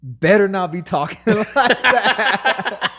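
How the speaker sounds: loud, high mom impression breaking into laughter; mock outraged mother. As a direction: scold like a mom, half laughing. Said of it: "my mom was like".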